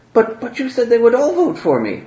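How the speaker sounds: put-on high, stammering young voice; surprised protest. Surprised Wolfgang turning to the Grand Theologian, high stammering protest. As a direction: protest in hurt disbelief. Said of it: "just totally surprised".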